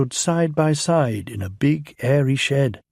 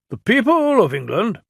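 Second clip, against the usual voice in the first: raised, pompous official voice; Fat Controller voice; 10 semitones higher, 5 dB louder than usual. Acted. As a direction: speak as a stern railway boss.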